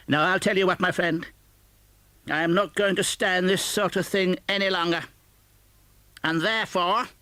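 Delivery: high, loud building threat; mock menacing boss. Scrooge pretending to threaten Bob, mock menace. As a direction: tease toward the surprise.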